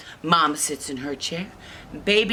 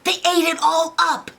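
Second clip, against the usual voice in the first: very high, loud little bear; squealed complaint; upset Baby Bear; 14 semitones higher, 5 dB louder than usual. Acted. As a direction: wail it like a small cub. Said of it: "when Baby Bear goes".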